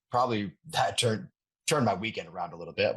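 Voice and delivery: ordinary delivery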